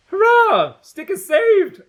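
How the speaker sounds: high, loud mock cheering; hammed-up hooray from the audience. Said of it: "I hear you cry".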